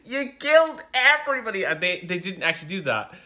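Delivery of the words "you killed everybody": high, outraged shout at the screen; outraged yell. Retold or imitated. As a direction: yell it in disbelief. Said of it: "'Cause I would have been like".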